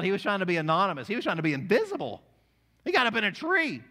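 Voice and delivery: punchy, emphatic preacher; forceful preaching. Acted. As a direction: preach it out, building to a high, loud finish.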